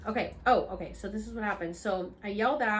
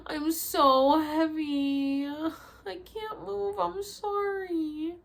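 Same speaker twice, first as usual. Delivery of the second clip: weak, slow moan; 6 semitones higher, much slower than usual. Retold or imitated. Drugged, unable to move.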